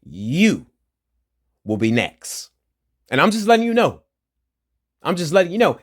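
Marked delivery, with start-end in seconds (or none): 3.1-5.8 raised, much higher voice, warning the police; heated warning. Real, not acted. Ranting at the police, a loud heated warning. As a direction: hammer the warning home, voice climbing.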